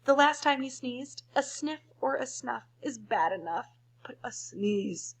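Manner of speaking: usual speaking voice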